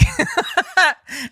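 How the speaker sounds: high laughing burst; high burst of laughter. Real, not acted. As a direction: crack up at yourself. Laughing at breaking the tee.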